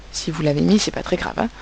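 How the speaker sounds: ordinary delivery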